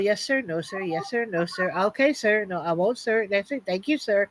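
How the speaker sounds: submissive yes sir. Retold or imitated. To a macho cop, meek and quiet politeness. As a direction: play it small and polite to the cop. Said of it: "I just pull the old".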